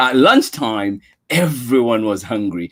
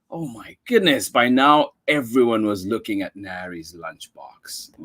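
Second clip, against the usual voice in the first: usual speaking voice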